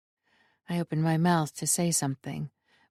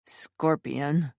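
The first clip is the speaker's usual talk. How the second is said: low warning tone; 3 semitones lower than usual. A narrator's reading. Alessio warning his brother, low warning. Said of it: "his tone filled with warning".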